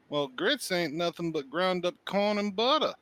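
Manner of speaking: folksy Southern drawl, slowly; southern accent. Pretend. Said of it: "having spent entirely too much time in the South".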